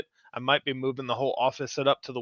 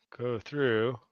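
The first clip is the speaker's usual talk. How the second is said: usual speaking voice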